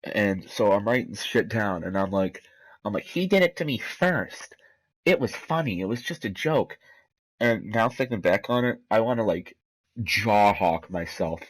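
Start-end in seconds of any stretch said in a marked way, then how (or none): 3.0-6.8 high, whiny mock-kid excuse; protesting kid. Kid defending himself to the principal, protesting. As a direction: plead your case in a higher voice, easing down.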